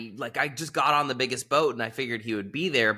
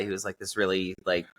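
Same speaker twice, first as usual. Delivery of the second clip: ordinary delivery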